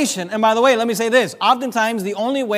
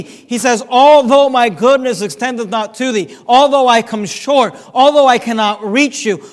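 loud, rising preacher's cry, hammering each although; full-force preaching; 2 semitones higher, 7 dB louder than usual. Acted. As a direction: preach it out, pleading for the congregation.

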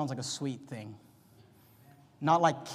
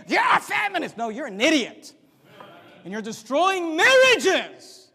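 much higher, louder, dragged-out shout; angry shout; 10 semitones higher, 9 dB louder than usual. Real, not acted. Preacher shouting at the men calling him a feminist. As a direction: hurl it at them in anger.

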